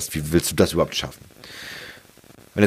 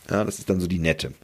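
ordinary talk, nothing marked

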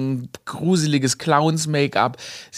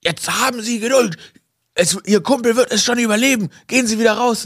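high, curt mock-stern nurse voice; snapping nurse; 9 semitones higher than usual. Hospital nurse shooing the costumed friend away, high snappish voice. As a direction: brush off the pest in the silly outfit. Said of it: "sie faucht mich an".

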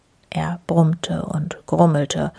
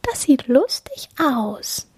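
high, slow little squirrel; 7 semitones higher than usual. A narrator's reading. Other squirrel admires the snow figures, high and slow. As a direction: draw it out in a small high voice. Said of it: "das andere sagt".

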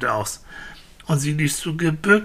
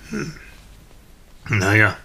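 ordinary delivery

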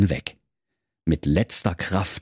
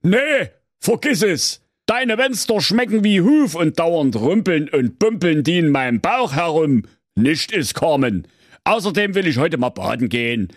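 refusing wolf; 9 semitones higher than usual. A narrator's reading. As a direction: turn the goat down, then dream of the beach. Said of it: "und er sprach".

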